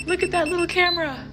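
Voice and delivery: 1 shocked discovery. Real, not acted. Same clip replayed.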